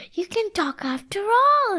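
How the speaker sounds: joyful discovery from the bear; happy cry. A narrator's reading. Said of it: "he cried happily".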